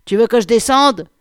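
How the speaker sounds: put-on man shouting down in a thick Landes accent. A narrator's reading. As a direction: call out loud and high with a southwestern French Landes accent. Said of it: "avec un accent qui n'avait rien à envier". (accent landais prononcé, crié de loin)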